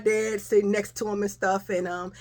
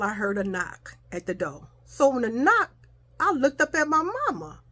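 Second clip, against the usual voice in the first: ordinary delivery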